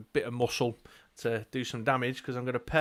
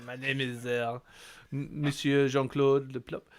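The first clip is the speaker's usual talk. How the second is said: put-on French accent. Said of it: "sound like an old French man".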